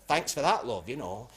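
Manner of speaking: ordinary talk, nothing marked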